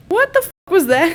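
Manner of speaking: high, loud mock outrage; shocked reaction. Katniss after a surprise kiss, shocked outburst. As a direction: exclaim it, appalled and high-pitched. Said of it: "she's just like".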